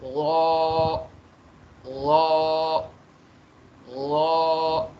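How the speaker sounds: लॉ said loud with lips rounded, a full heavy sound; a drawn-out heavy लॉ, like an Arabic reciter. Shown as a demonstration. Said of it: "और फिर होंठ गोल हो जाएंगे".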